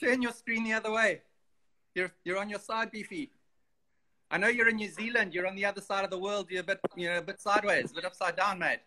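words muffled behind a mask; masked, muffled call voice; through a face mask. Real, not acted. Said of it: "I've got my mask on".

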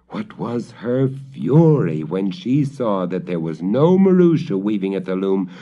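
plain reading voice

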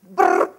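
rapid trilled "brrr"; imitated rattling noise. Speaker mimics programmers churning out lines of code, rattling brrr. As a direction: roll out a mock machine-gun brrr.

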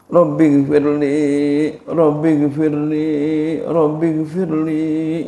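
baca lambat dan khusyuk, kata demi kata; doa pelan. Shown as a demonstration. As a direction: Rabbi gfirli diulur pelan-pelan. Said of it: "Baca tiga kali". (membaca doa pelan, penuh penghayatan)

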